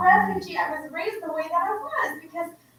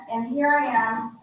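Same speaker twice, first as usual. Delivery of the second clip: ordinary delivery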